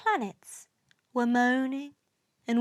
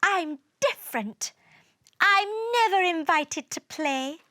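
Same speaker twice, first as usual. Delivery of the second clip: higher-pitched, aggrieved character voice; sulky complaint; 7 semitones higher than usual. A narrator's reading. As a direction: complain like someone left out. Planet complaining in read story.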